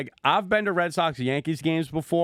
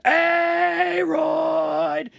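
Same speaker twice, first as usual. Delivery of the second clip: high, loud, stretched-out scream of the name; drunken yelling; 5 dB louder than usual. Retold or imitated. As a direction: bellow it like a drunken heckler in the stands. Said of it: "I'm just drunkenly yelling".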